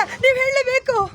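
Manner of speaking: high, loud demand; insistent shout. Shown as a demonstration. Director showing the actor a demand, loud insistent shout. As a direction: demand an answer at full voice.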